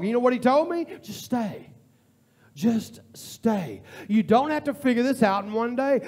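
ordinary delivery

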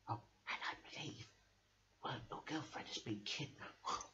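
breathy secret whisper. Acted. Telling Werther his girlfriend is kidnapped, whispered. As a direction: whisper the news in his ear. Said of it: "may I whisper in your ear".

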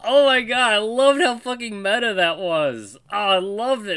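high, loud delighted squeal of praise; overjoyed outburst; thrilled gush. Real, not acted. Laughing at a meta joke.

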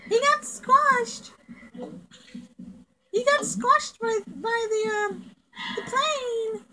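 high, slow squeaky voice; squeaky character voice. Acted. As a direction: talk like a tiny squashed critter. Voicing squashed character.

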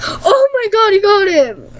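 very high, thrilled squeal; high-pitched gleeful outburst; excited squeal. Real, not acted. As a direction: squeal it in amazed delight. Delighted at the guessed picture.

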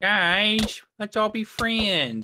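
sweet peacemaker voice. Pretend. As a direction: coo it like a kindergarten teacher.

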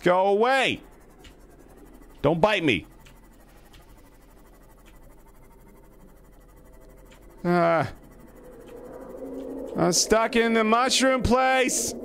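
high-pitched distressed wail; trapped and yelling for help; panicked pleading. Real, not acted. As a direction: cry out for rescue, high and desperate. Trapped and attacked in game.

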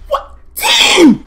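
very high, loud exclamation; explosive high-pitched outburst; shocked yell. Real, not acted. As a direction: shriek it in amazement. Reacting to the game.

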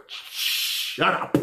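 whispered through laughter; collapsing into silent laughter; breathless laugh. Real, not acted. Laughing at the haters, too weak to speak. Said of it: "deserves one good laugh".

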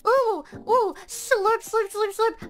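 high voiced slurp slurp slurp; mock slurping. Streamer voicing slurps while drinking potion, high and playful. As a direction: say slurp like a cartoon drinking noise.

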